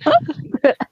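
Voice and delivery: a quick high chuckle; short high laugh. Real, not acted. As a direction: laugh along. Laughing along with friends.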